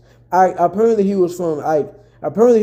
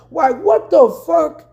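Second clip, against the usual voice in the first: high, slow, stunned "what the fuck"; outraged disbelief; 9 semitones higher, slower than usual. Real, not acted. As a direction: let the disbelief ring out, slowly. Outraged at police threats.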